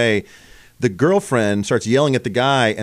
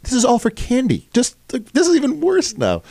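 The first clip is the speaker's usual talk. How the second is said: much higher, pitch climbing in disbelief; incredulous exclamation; 13 semitones higher than usual. Retold or imitated. Retelling the grandma who sprinted off, in disbelief. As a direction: squeak out stunned disbelief at the absurd getaway.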